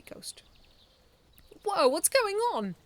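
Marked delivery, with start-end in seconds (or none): 1.6-2.7 high, loud startled call; surprised shout. A narrator's reading. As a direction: shout in confusion. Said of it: "Medaby then shouted".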